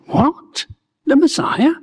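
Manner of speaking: high, astonished double-take on the Messiah; startled disbelief. Retold or imitated. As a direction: gasp it out as if you can't believe your ears. Narrator startled by the claim.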